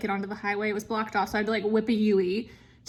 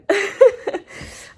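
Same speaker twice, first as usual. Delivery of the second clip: high, loud sob-laugh breaking out; a tearful laugh as the crying starts; 10 semitones higher, 9 dB louder than usual. Real, not acted. Missing her husband, telling viewers, tearful sob-laugh. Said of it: "I'm gonna start crying again".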